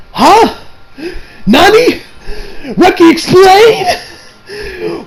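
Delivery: high-pitched, drawn-out comic bewilderment; baffled disbelief. Acted. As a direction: squeal each question in mock shock. Acted baffled reaction to author.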